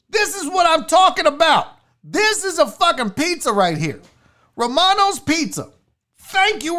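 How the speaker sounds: high-pitched, emphatic hype; excited, punchy rant; fired-up approval. Real, not acted. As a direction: cheer it on like a fan. Ranting approval of a real pizza.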